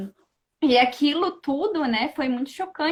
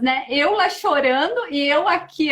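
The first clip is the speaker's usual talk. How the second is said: ordinary delivery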